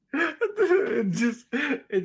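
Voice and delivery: high, choked with laughter; squeaky words through helpless laughs; real laughing fit. Real, not acted. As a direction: try to talk while cracking up. Cracking up at grandma joke.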